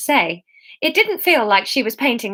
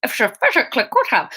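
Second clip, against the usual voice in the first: a brief Sean Connery slur on physically; fast, slurred Scottish Sean Connery touch; Sean Connery slip; 3 semitones higher, faster than usual. Retold or imitated. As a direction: slide into a Sean Connery lilt. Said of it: "Sean Connery taking over".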